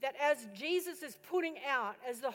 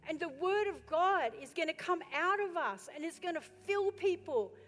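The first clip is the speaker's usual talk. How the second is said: excited realisation; 5 semitones higher, 5 dB louder than usual. Retold or imitated. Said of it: "he goes".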